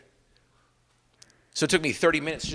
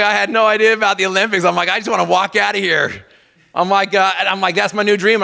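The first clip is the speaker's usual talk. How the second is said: excited; 5 semitones higher, 5 dB louder than usual. Retold or imitated. Excited retold hospital resolve.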